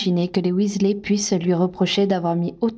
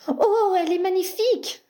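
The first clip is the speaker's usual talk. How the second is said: mock squeal of gushing girlfriends; very high-pitched imitation; 11 semitones higher than usual. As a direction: imitate an admiring squeal, much higher. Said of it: "d'une voix terriblement aiguë". (imitation d'une voix terriblement aiguë)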